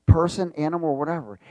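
ordinary talk, nothing marked

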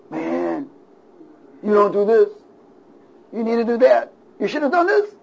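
high-pitched mock nagging, climbing line by line; accusing inner voice. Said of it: "you're being accused to death".

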